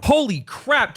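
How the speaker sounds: astonished. Real, not acted. Host learns the show floor is fuller, high astonished outburst. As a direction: blurt it in real surprise. Surprised by ticket sales news.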